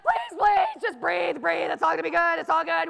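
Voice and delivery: mock panic, begging yourself to breathe; acted-out frantic pep talk; frantic self-soothing.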